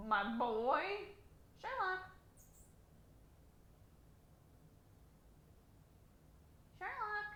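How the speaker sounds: soft high call to the cat. Real, not acted. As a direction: coo his name gently. Calling the cat softly.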